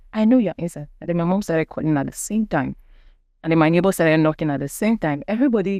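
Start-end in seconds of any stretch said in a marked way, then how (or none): 0.1-0.9 insistent repeat. Retold or imitated. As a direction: press it home this time. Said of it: "She said".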